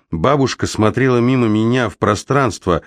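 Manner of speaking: plain reading voice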